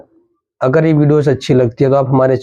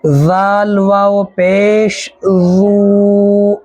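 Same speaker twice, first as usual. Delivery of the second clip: slow sing-song spelling drill, stretching the last vowel; slow recitation drill; 5 semitones higher, much slower than usual. Shown as a demonstration. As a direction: chant each syllable for students to repeat. Teacher drills letters with waw madda.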